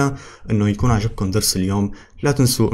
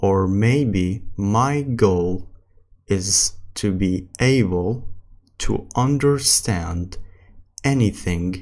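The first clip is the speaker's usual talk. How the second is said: very slow English with long pauses; slow practice reading. Shown as a demonstration. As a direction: read word by word for learners. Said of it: "السرعة الثالثة اللي هي الطبيعي".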